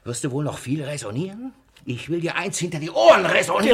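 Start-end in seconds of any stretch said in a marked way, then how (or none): 1.9-3.7 far louder and fast, threatening; angry threat. Acted. Master threatening a cheeky servant, loud fast anger. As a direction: rattle off the threat at him.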